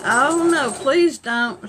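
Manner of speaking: loud, high, begging no; pleading cry. Retold or imitated. Granny Mac begging her son not to drive. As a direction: beg him loud not to let it happen. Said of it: "And Granny Mac said".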